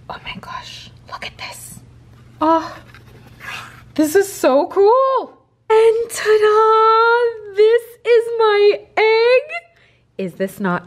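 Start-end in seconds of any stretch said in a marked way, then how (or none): 0.1-1.8 gasping whisper. Real, not acted. Seeing the box. 2.4-9.4 high loud slow squeal; excited squeal. Real, not acted. Unboxing the egg.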